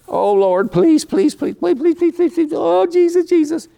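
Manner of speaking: high, pleading whine of a desperate pray-er; mock begging prayer. Preacher mocking how some pray, high begging whine. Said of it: "it's not begging".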